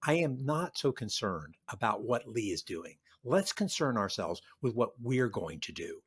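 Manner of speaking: low, soft, unhurried and steady; calm. Retold or imitated. Grant answering his panicked officers, calm and quiet. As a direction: quietly settle the room, no alarm at all. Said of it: "Grant just looks very calmly and says".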